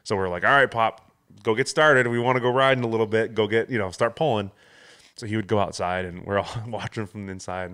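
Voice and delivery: usual speaking voice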